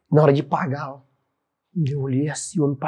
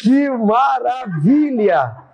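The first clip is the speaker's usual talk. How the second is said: slow marveling exclaim; 6 semitones higher, 3 dB louder, much slower than usual. Real, not acted. Pastor marvels slowly.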